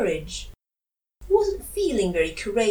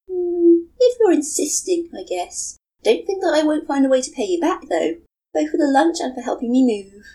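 put-on young woman voice; 8 semitones higher, 6 dB louder than usual. Matsuri's voice held.

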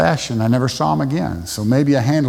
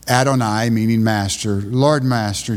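ordinary talk, nothing marked

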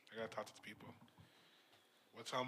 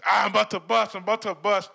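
loud, higher, amped-up bragging; hyped; 6 semitones higher, 14 dB louder than usual. Retold or imitated. Gambler hyping his parlay to his boys, much louder. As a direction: brag to the group chat, all pumped. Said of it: "You're amped".

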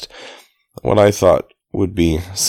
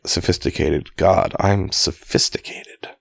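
"God, I'm sophisticated" susurrado, lento y sin voz; whispered sophisticated; 4 dB quieter, slower than usual. Shown as a demonstration. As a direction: breathe it slowly, almost unvoiced. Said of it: "or it could be like".

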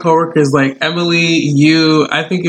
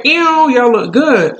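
mock ew compliment; 7 semitones higher, slower than usual. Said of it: "And I was like".

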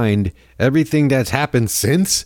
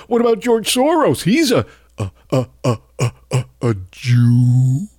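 mock right-wing stammer. Host mimics a bigot stammering out his real meaning, mocking parody voice. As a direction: parody a bigot fumbling toward the real point. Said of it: "right-wing racists and Nazis are quick to point out".

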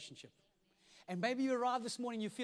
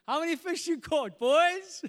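high, loud shouted question; calling out; 9 semitones higher, 13 dB louder, slower than usual. Acted. Jesus asks the men in the boat, calling out. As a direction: holler it out, lifting on the last word.

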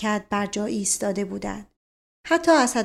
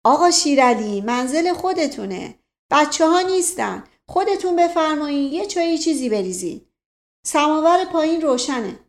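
raised voice calling into the courtyard; polite call down to the butcher; calling to the yard; 6 semitones higher, 4 dB louder than usual. A narrator's reading. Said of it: "رو به حیاط گفت".

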